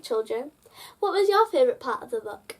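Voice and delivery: ordinary delivery, nothing marked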